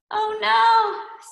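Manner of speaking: high, loud cartoon kid voice; a clumsy kid yelping in dismay; squeaky Klutzy Boy.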